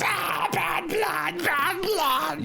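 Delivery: very high, drawn-out creature shriek; Twiggy shrieking at a foul taste; disgusted creature cry. Acted. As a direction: wail it slowly in high-pitched disgust. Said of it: "grimaces and goes".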